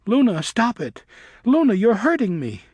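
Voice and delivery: hurt bat crying out to his attacker. A narrator's reading. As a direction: beg her to stop through pain. Griffin being bitten.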